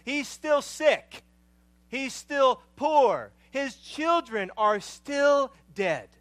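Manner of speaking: loud, high, impassioned sermon delivery; fervent preaching. Acted. Pastor preaching loudly to his congregation about Job. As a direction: preach it out to the whole room.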